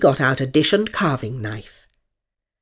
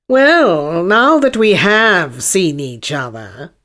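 haughty unicorn; 5 dB louder, slower than usual. Acted. Unicorn as voiced figure.

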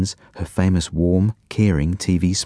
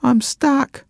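put-on high little boy calling from far off; stuck child calling. A narrator's reading. Leo calling from the locked toilet, high distant child voice. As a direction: call it through the door. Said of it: "yelled Leo".

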